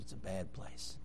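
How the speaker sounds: imitating a shy little girl's whisper; bashful whisper. Preacher voicing a bashful girl's whispered answer. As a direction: barely breathe it, head down. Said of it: "She's very bashful".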